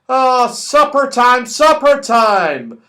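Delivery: high, loud, slow call; excited shout. Acted. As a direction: announce supper with glee. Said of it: "shouted Moonface suddenly".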